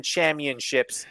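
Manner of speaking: "championships" drawn out as cham-yun-ships; imitated mispronunciation. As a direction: slowly leave the P out of "championships". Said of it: "do you not pronounce the first P in championship".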